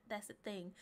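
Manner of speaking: ordinary delivery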